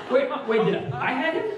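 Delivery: high, laughing disbelief; laughing panic. Retold or imitated. Father realizing he forgot his son, laughing high. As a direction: stammer it through a laugh, pitch up.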